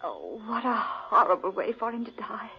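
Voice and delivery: horrified tearful whisper; grieving whisper over a dead friend's body; whispered grief. Acted. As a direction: breathe it out in a shaken, weeping whisper. Finding friend's strangled body.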